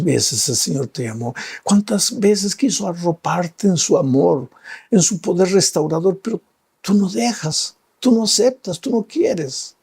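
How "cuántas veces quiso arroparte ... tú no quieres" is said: higher, climbing preacher's appeal; impassioned preaching. Acted. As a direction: press the listener with rising fervour. Preaching at full force.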